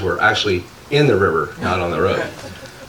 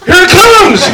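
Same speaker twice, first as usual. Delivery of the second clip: much higher and louder laughing yell; retold warning shout; 10 dB louder, slower than usual. Joey at the gate warning that the llama is coming, laughing yell. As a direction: shout the alarm as it charges. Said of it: "turns around and goes".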